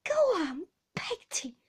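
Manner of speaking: high, fearful urging; frightened child's plea, dropping away. A narrator's reading. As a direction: beg the nurse on in fright. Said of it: "more frightened than before".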